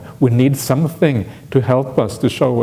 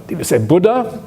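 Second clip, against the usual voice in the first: higher, rising in wonder; astonished repeat; 7 semitones higher than usual. Retold or imitated. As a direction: ask again in disbelief. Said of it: "Three times, always three times".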